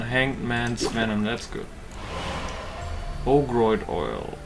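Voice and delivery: usual speaking voice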